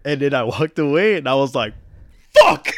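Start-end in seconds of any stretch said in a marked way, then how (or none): 2.4-2.7 loud, high yelp of fuck; frustrated curse. Retold or imitated. Blurting the curse aloud after blowing it with the dad. Walking away after dad's nod.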